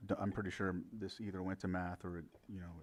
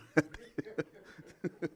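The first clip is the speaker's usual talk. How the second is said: quick embarrassed laugh; short laugh; 9 semitones higher, 4 dB louder than usual. Real, not acted. Laughs after bullied remark.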